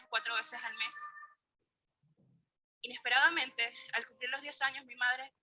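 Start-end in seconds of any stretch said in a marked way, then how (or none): none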